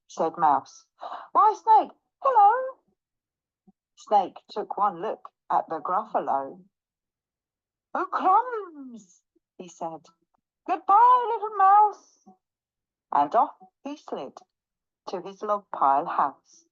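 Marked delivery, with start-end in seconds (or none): 1.3-2.8 high, loud, sing-out hello; bright-eyed mouse hailing, pitch soaring; gleeful greeting. Acted. Mouse boldly greets Snake. 7.9-9.2 high, drawn-out alarm; hammed-up little fright; dismayed snake. Snake sees Gruffalo, dismayed. 10.7-12.3 high, loud hasty goodbye; shrieky storybook panic; panicked farewell. Acted. Snake hurriedly flees.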